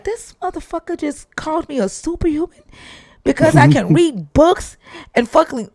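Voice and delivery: high, heated disbelief; indignant outburst. Retold or imitated. Founder fuming at the investor's remark, heated outburst. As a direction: let the outrage rise. Speaker's indignant inner reaction.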